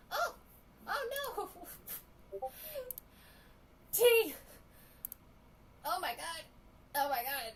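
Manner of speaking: high, loud shocked cry. Real, not acted. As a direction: gasp it out in shock. Shocked by burnt tea.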